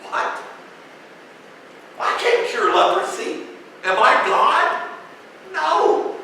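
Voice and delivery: high, loud flustered protest, slowing on Am I God; a king panicking at an impossible request; king's panicked reaction. Retold or imitated. King refusing a leprosy cure, high and loud in mock panic. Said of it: "I love his reaction".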